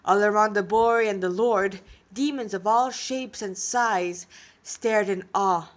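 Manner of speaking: ordinary delivery, nothing marked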